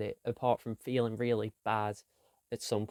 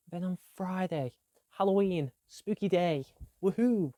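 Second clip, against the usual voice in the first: higher, bright woohoo for spooky day; excited cheer; 6 semitones higher than usual. Acted. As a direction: hype it up like a party. Cheering for Halloween.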